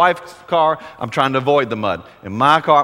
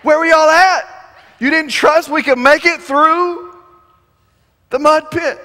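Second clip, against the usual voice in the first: much higher, louder playful teasing; mock teasing; 12 semitones higher, 6 dB louder than usual. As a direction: tease the kids for doubting you. Father teasing scared kids.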